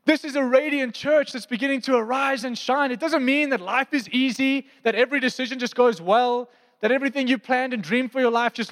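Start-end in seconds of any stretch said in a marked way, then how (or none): none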